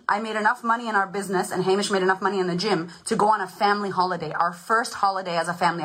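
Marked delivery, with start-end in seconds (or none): none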